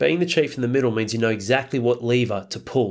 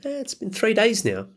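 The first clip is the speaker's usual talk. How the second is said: higher, lightly voiced sheepish client; a mild hint of a hesitant bloke admitting it; 10 semitones higher than usual. Client telling Al how long, lightly voiced and sheepish.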